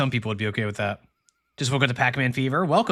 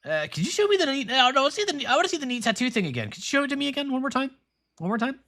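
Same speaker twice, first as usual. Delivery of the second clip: put-on high, fast eager kid begging to see it again; eager young hero; 11 semitones higher, faster than usual. Young hero begging the pirate for another tattoo trick, high and eager. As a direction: plead like an excited boy.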